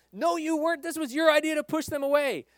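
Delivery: high, sharp comeback; accusing disciple. Retold or imitated. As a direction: call out the other disciple's lie. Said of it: "the disciples' conversation".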